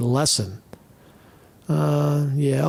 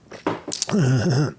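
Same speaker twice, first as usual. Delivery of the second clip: harsh coughing fit; choking cough. Real, not acted. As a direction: cough like it went down the wrong pipe. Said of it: "went down the wrong pipe".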